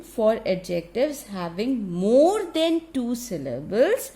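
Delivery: normal talking voice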